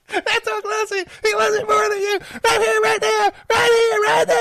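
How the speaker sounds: put-on high, loud drunken shouting girlfriend; drunk Holly voice. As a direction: belt it out like a tipsy woman demanding a wrestle. Drunk Holly answers back.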